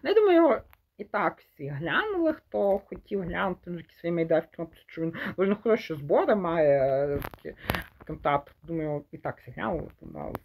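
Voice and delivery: ordinary delivery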